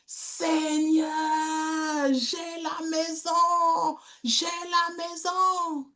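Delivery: voix d'enfant haute et lente qui s'émerveille; cri de joie imité. Retold or imitated. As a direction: imite l'enfant qui remercie le ciel, aigu et étiré. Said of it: "il lève les mains au ciel et il crie". (cri de joie d'enfant imité, aigu et lent)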